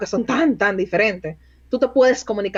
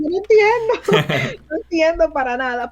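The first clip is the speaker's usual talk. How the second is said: laughing disbelief; 6 semitones higher than usual. Retold or imitated. Mock confusion at Montreal French.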